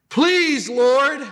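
high, loud desperate plea; pleading. Acted. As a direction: beg the Lord, voice pushed up high. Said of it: "Now he starts to plead".

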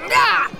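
sharp pained yelp; cry of pain. Acted. Shoulder pain.

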